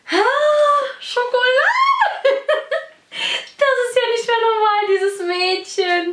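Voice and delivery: high, squealing delight with a laugh. Real, not acted. Vlogger finding chocolate from her girlfriend, high delighted squeal.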